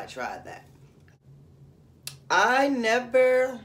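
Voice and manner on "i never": emphatic. Real, not acted. Emphatic start of story.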